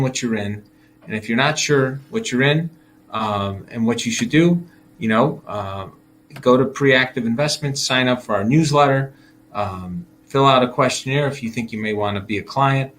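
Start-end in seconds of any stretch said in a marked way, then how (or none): none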